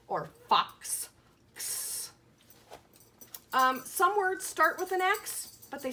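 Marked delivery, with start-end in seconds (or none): 0.1-2.1 fox with the ks ending, then X whispered as ks; x ending sound. Shown as a demonstration. Teacher models the ks ending for children, whispered. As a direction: land on the ks of fox. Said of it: "Hear that?".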